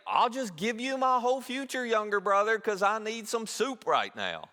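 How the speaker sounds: preacher mocking someone who sells his future for soup; mock foolish trader. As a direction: ham up the silly bargain. Mocking whoever would trade birthright.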